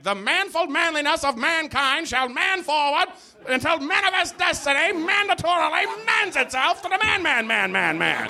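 high, booming mock-heroic proclamation; pompous statue inscription. Comic retelling of a pompous inscription, mock-heroic. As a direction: declaim it like a grand old monument. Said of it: "all of them are like".

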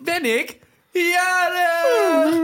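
high, loud and drawn out; excited announcement. Real, not acted. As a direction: belt it like big news. Announcing his birthday.

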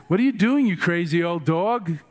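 hammed-up indignant question; incredulous scolding. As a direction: act out scandalised monks challenging him. Acting the monks scolding Patrul.

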